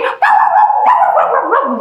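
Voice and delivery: high yappy barks like a puppy; dog barks. Retold or imitated. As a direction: yap it high like an eager dog. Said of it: "she said".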